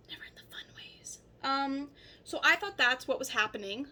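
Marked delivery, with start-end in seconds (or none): 0.1-1.2 whispered cheeky repeat; whispered aside. Shown as a demonstration. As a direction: whisper it as a secret. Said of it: "And never in the fun ways".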